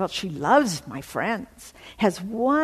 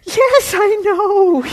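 high, loud tearful reply; sobbing joy; 13 semitones higher, 13 dB louder, much slower than usual. Real, not acted. Answering friend's news, crying with joy. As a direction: answer through happy tears. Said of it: "I'm just bawling".

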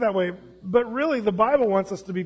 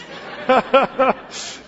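laugh; 5 semitones higher, 5 dB louder than usual. Real, not acted. Laughs at the orange story.

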